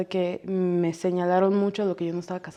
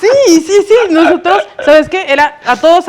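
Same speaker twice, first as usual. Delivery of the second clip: talking through laughter, much higher and louder; laughing; 9 semitones higher, 13 dB louder than usual. Real, not acted. Telling her old classmate the phone story while laughing loudly. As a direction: squeal the words out between laughs. Laughing over the school memory.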